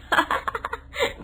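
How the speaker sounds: a short, high breathless giggle. Acted. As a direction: giggle slips out. Giggle at father-in-law. (ऊँची खिलखिलाहट)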